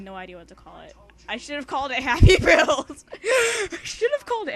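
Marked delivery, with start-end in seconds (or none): 1.3-3.0 laughing through the words, loud; cracking up at her own joke; giggling out the title. Real, not acted. 3.1-4.5 squeaky giggling repeat; laughing. Real, not acted.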